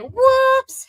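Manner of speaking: high, loud playful whoops; mock whoops. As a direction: imitate a big, unapologetic oops. Acting out her unbothered oops reaction.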